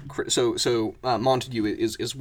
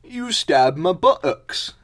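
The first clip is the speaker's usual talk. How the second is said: loud, slow, high accusation; hammed-up wounded indignation; aggrieved mole; 8 semitones higher, 9 dB louder, slower than usual. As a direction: complain loudly, dragging out the words. Stabbed mole accuses the other.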